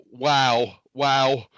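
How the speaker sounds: normal talking voice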